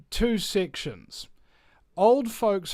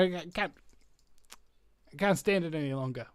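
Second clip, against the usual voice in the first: usual speaking voice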